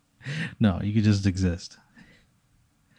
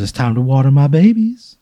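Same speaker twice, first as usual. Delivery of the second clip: higher, louder Southern lady singing out; Southern woman; 5 semitones higher, 7 dB louder than usual. Acted. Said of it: "like, a Southern woman's voice".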